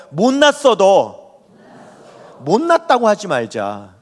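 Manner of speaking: slow, spaced out for the congregation to echo; a pastor pacing a phrase so the room can repeat it; slow repeat-after-me line. Shown as a demonstration. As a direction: lead the church line by line, slowly. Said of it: "자, 한번 따라해 볼까요?".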